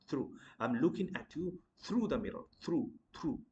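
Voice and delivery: through as "throo"; rounded long "oo" in through; correct pronunciation. Shown as a demonstration. Teacher drilling it in a sentence, through as "throo". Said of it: "in a round shape".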